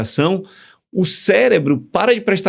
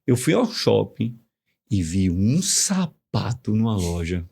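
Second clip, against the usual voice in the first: softer, more expressive take; 6 semitones lower, 5 dB quieter than usual. Shown as a demonstration. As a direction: lower and softer, slow and warm. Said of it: "Qual é melhor?". (frase dita mais suave e expressiva)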